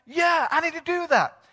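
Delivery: high, loud, emphatic yes; retold eager agreement. Woman agreeing to accept Christ, eager. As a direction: imitate her jumping at the offer.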